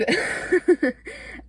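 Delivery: high laugh trailing off; breathless laugh. Real, not acted.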